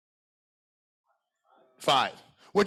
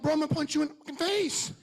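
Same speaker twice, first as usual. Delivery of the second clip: higher, offhand mock threat; retold threat; 11 semitones higher than usual. Speaker replays his own mock threat to coworker in a higher voice. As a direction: toss off the threat in a put-on voice. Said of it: "And I'm like".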